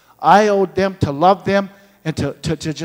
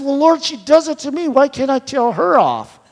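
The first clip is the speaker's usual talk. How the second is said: mock whiny plea to God, pitched higher; pleading; 7 semitones higher than usual. A preacher telling the Lord his stepmother wrongs him, pleading. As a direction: protest to the Lord like a hurt kid.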